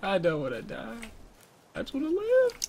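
high, gasping plea for life; scared horror game streamer. Real, not acted. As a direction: beg for your life in panic. Gasps, pleading while chased.

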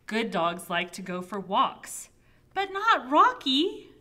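animated storybook narration, loud opening stresses; reading to children. Acted. Child narrator describing a pet, animated storybook reading. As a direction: read it out with big swings in pitch. Reads picture book aloud.

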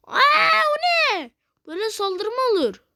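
very high, loud shocked cry; shocked outcry. Real, not acted. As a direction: yelp in disbelief at the attack. Shocked at enemy attack. (şaşkın çığlık)

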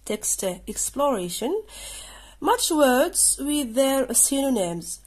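very slow, each word spaced; slow task reading. Shown as a demonstration. Teacher reading the exercise title to pupils, very slow. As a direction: read the instruction slowly. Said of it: "reading task heading".

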